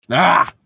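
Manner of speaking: sharp high exasperated ah; a short anguished cry; pained yelp. Real, not acted. Reader groaning at the bad fanfic, high pained cry.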